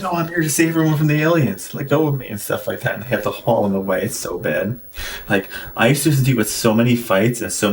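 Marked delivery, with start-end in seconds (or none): 0.0-2.3 mock frantic alien-saver. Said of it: "He's like".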